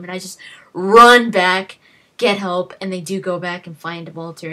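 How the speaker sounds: ordinary delivery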